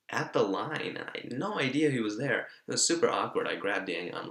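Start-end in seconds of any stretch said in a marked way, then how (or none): none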